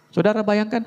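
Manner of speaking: ordinary talk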